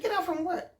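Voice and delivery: high-pitched disbelief; retold incredulous query; startled question.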